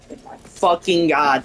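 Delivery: ordinary talk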